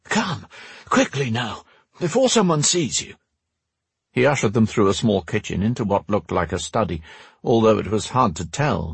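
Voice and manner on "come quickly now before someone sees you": put-on high, urgent old man's voice; urgent, high-pitched ushering; frantic urging. A narrator's reading. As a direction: hurry them inside anxiously. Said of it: "began to wave them inside frantically".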